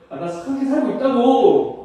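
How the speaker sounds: insistent protest. Acted. Unbeliever arguing he lives a good life, insistent protest. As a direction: insist on it, acting out the objector.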